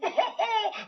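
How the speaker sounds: high gloating villain cackle; triumphant mocking laugh; evil laugh. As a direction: cackle over the wicked plan. Villain gloats over his plan.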